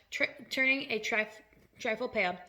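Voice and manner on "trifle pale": plain reading voice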